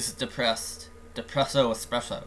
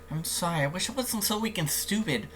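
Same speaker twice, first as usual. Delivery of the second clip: put-on higher-pitched, self-blaming girl; apologetic Sayori; 6 semitones higher than usual. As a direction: play a depressed friend apologizing in a high voice. Voices Sayori in the game.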